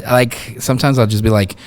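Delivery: usual speaking voice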